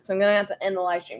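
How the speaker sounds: usual speaking voice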